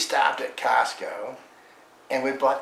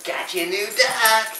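high pet talk; 10 semitones higher, 6 dB louder than usual. Real, not acted. Giving dog the toy.

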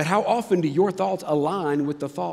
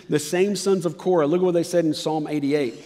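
normal talking voice

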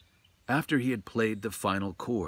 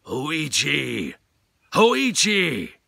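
booming call, louder and higher on the second; 7 semitones higher, 11 dB louder, much slower than usual. A narrator's reading. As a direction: shout his name from right beside him. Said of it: "very near now and very loud".

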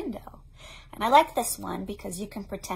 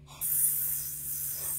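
whispered hiss; soft sustained snake hiss; 16 dB quieter than usual. Acted.

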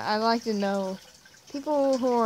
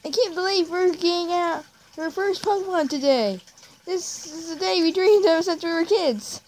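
high, loud, gushing cartoon kid; excited friend character; 7 semitones higher, 4 dB louder than usual. Jake tells friend about first Pokemon, excited high voice. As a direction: play a thrilled best pal, pitched way up. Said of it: "I literally cannot do any of these voices".

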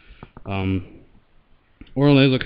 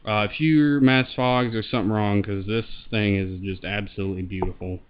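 muffled voice behind a mask; talking through a respirator; through a gas mask; 4 dB louder than usual. Real, not acted. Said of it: "I'll throw it on here and show it, and I'll talk into the mic".

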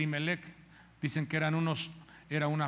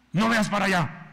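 controlling husband; 6 dB louder than usual. Retold or imitated.

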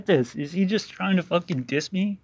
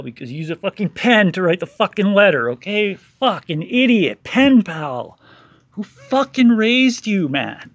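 angry rant; 4 semitones higher, 8 dB louder than usual. Real, not acted. Furious rant at critic.

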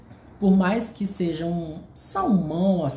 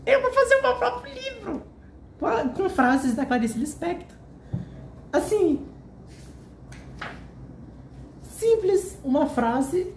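much higher, bright excited plan; 14 semitones higher than usual. Real, not acted. Telling viewers he'll write his own book, high excited voice. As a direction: pitch it high like a thrilled new idea.

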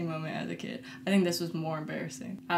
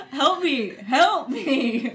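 hammed-up cry for help, higher pitch; mock panicked plea; 5 semitones higher, 5 dB louder, much slower than usual. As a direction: plead for help in mock panic, half-laughing. Retelling own panicked plea as child.

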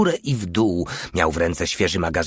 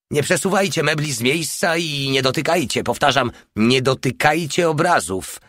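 higher-pitched insistent caution; urgent warning; 6 semitones higher than usual. A narrator's reading. Porta warning comrades about booby traps, insistent. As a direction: warn them firmly, pitch raised.

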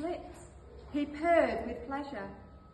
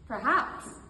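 louder, confident little sheep; proud answer; 3 semitones higher, 7 dB louder than usual. Acted. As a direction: answer back with a touch of pride. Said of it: "the sheep answered proudly".

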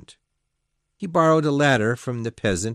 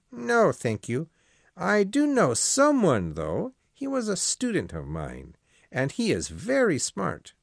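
a lightly voiced old priest, higher than the narration; Father Perard, raised pitch; 9 semitones higher than usual. Old priest refusing the Marquis's job offer, lightly voiced. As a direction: play the priest declining politely, pitched up.

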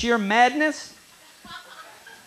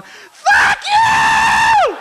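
higher, louder laughing shout; teen crowd yelling; 8 semitones higher, 6 dB louder than usual. Retold or imitated. Comic voices the teenage crowd jeering at Alan King, shouting. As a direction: yell it like an angry mob of teens. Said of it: "teenagers going".

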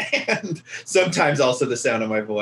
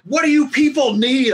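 exasperated; 11 semitones higher, 4 dB louder than usual. Retold or imitated. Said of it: "I was like, I was like".

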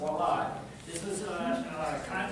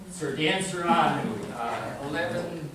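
ordinary talk, nothing marked

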